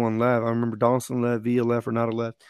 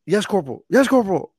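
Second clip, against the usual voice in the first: acted obedient reply; 7 semitones higher, 4 dB louder than usual. Junior answering while being chewed out.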